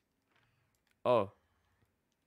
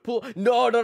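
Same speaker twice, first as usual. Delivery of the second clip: excited; 11 semitones higher than usual. Real, not acted. Hyping himself up for gacha.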